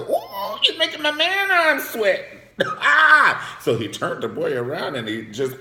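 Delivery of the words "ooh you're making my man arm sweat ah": very high, loud comic whoop; over-the-top high exclamation; mock scandalized squeal. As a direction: squeal it in hammed-up shock. Acting out scandalized reaction to story.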